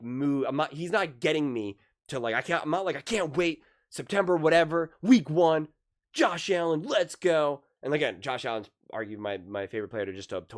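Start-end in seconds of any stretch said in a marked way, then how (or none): 3.0-7.6 loud, high countdown hype; mock fan excitement. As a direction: whoop it up like a pumped fan. Acts hype he does not feel.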